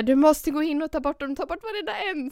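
high-pitched, urgent begging; desperate plea. Retold or imitated. Frightened girls beg the guide to remove spiders, desperate. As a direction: plead with him to clear the tent. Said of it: "vi bara så här".

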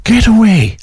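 high, startled get away; a real jolt of surprise at the news; surprised disbelief. Real, not acted. Host reacts to surprising news.

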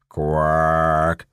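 a low, drawn-out croak of a quack; low toad quack. Acted. Toad answers the woman, a low drawn-out quack.

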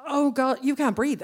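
put-on a young doctor suddenly realising she can't breathe; alarmed doctor. Young doctor realises the patient can't breathe, alarmed.

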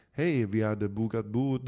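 put-on light German accent, slowed down; a German-accented guest reports a booked table. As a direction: greet in Swedish with a hint of German accent. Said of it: "svensktalande med tysk brytning".

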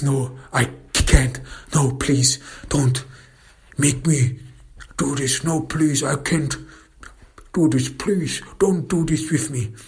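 high-pitched, whimpering begging; desperate pleading. Acted. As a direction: beg to be spared, voice climbing. Man begs not to confess.

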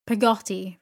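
loud, indignant repeat; some indignation. A narrator's reading. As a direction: throw the name back, affronted. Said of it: "repeated Miss Betsy with some indignation".

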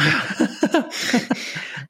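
high-pitched burst of laughter; a real laugh that breaks out. Real, not acted. Reader breaks off the Facebook post, laughing.

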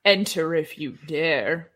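spooky ride narrator. Pretend. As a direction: invite them in like a creepy host. Spooky outro voice.

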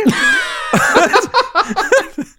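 a high, loud burst of laughter. Real, not acted. (lautes, hohes Lachen)

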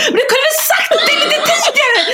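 laughing, very high mock shout; mock angry shout. Wife shouting at her husband about the missed exit, laughing mock shout. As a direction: yell it in half-joking outrage. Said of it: "Jag är så fruktansvärt arg".

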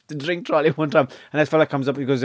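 ordinary talk, nothing marked

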